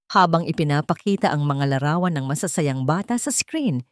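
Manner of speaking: ordinary delivery, nothing marked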